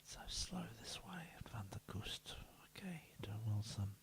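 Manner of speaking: breathy hushed whisper, almost no voice. Acted. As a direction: lean in and whisper the praise softly. Whispering encouragement to players.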